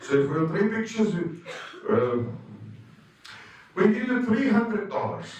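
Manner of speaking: lightly put-on Norwegian client voice; deliberately bad Norwegian accent, playful. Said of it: "I'm doing a really bad Norwegian accent".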